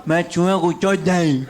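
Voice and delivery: slow, loud, slurred growl; hammed-up slurred boast; mumbled tough-guy quote. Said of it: "वो ऐसे नहीं बोला।".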